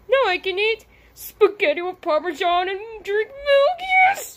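building to a delighted squeal; overjoyed. Pretend. Mario overjoyed he can eat cheese.

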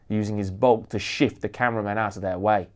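ordinary delivery

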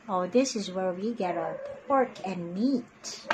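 usual speaking voice

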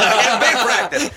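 high, loud and laughing; laughing quote. Retold or imitated. Telling friends his excuse, laughing loud. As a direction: repeat his excuse through giggles. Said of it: "He said".

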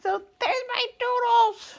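high sing-song coo. Real, not acted. Owner showing off her doodles, high cooing. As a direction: croon over your dogs. Calling the dogs.

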